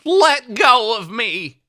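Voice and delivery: high, slow, pleading robot. Pretend. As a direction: beg like a captured robot.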